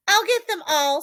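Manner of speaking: put-on a flustered boy promising to save his lemonade, eager. A narrator's reading. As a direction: rush to reassure her he'll fix it. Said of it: "said Evan".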